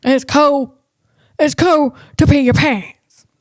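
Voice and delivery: light attempt at a goofy comedian voice; half-landed Sandler impersonation; Adam Sandler impression. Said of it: "I'm trying to do his accent".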